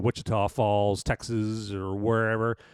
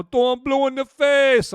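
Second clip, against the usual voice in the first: silly voice; 13 semitones higher, 8 dB louder, slower than usual. Pretend. Joking aside, pitched up.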